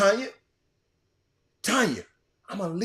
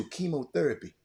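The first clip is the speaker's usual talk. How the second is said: ordinary talk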